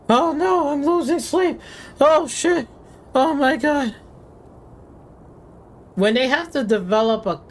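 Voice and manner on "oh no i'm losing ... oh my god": higher, whiny mock panic; hammed-up fretting of a frame-rate crybaby. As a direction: parody a gamer freaking out over frames. Mocking panicking frame-rate complainers.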